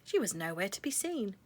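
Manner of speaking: plain reading voice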